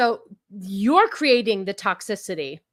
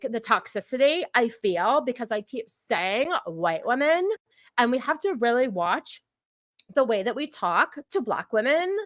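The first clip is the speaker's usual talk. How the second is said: normal talking voice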